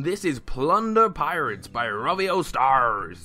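slow, drawn-out pirate accent. Shown as a demonstration. Host announcing the game's name to viewers, hammy pirate accent. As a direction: put on a pirate accent, stretching the words. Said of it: "That's my pirate accent".

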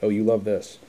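ordinary delivery, nothing marked

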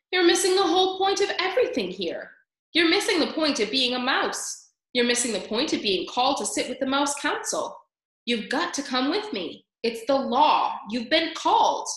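high-pitched, worked-up scolding; insistent, fed-up Furlow; exasperated brother mouse. A narrator's reading. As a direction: hammer the point home to a clueless brother.

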